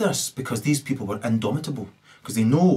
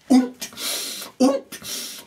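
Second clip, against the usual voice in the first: comic grunts; 13 semitones higher than usual. Retold or imitated. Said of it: "he did this".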